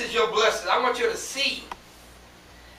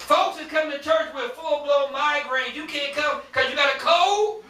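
raised, incredulous preaching; 6 semitones higher, 5 dB louder than usual. Real, not acted. Preacher scolds absentees over a cold, high incredulous challenge. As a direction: challenge them, rising into a loud question.

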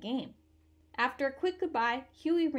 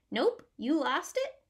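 put-on old neighbor man; 4 semitones higher, 4 dB louder than usual. Neighbor answers Huey.

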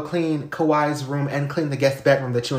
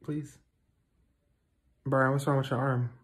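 ordinary talk, nothing marked

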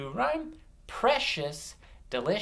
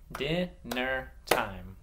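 "Dinner time" broken into separate beats; "Dinner time" slowly segmented for blending; slow syllables; much slower than usual. Shown as a demonstration. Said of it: "I'm gonna say them slow and clap".

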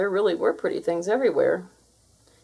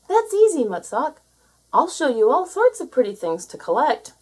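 high, bright excited voice; eager seal; 6 semitones higher, 4 dB louder than usual. Acted. Seal eagerly offers the creature help.

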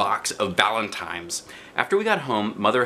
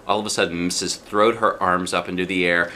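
usual speaking voice

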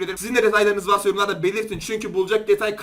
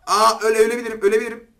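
alarmed; 2 semitones higher, 7 dB louder than usual. Real, not acted. Near death in match.

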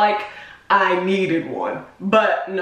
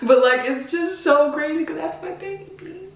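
tearful; 9 semitones higher than usual. Real, not acted. Crying over birth video.

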